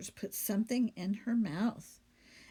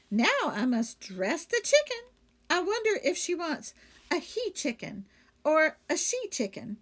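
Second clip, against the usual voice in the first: cheerful maid voice; 6 semitones higher, 4 dB louder than usual. Acted. Amelia Bedelia character voice.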